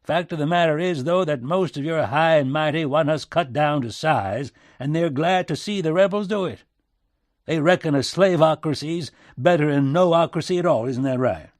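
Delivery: loud, high, agitated back-country American; worked-up back-country twang; agitated back-country accent. A narrator's reading. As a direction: argue heatedly in a strong backwoods accent. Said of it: "back-country accent growing stronger with his agitation".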